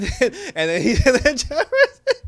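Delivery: high, loud laughter breaking into the words; cracking up. Real, not acted. Recapper losing it while retelling the argument, cracking up. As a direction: try to talk through a laughing fit.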